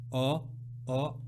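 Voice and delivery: ordinary talk, nothing marked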